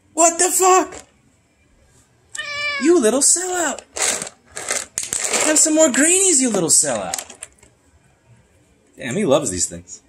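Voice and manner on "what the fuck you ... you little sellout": much higher mock-indignant squeal; high put-on voice scolding the cat for his free treats; squeaky mock outrage.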